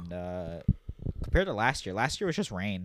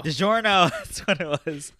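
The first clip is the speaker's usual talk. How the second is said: excited recall; 8 semitones higher, 8 dB louder than usual. Real, not acted. Suddenly remembering the pizza brand.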